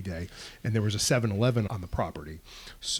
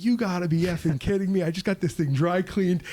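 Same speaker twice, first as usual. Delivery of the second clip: raised, exasperated mock outburst; imitating a furious coworker; retold angry rant; 6 semitones higher than usual. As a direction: replay a coworker's fury at his ruined uniform, pitched up. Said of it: "He was so mad".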